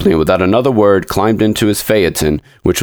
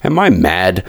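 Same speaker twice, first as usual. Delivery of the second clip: ordinary delivery, nothing marked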